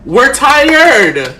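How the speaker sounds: loud, fed-up outcry; exasperated. Real, not acted. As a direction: let the exhaustion burst out loud. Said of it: "this is why I'm pissed the fuck off".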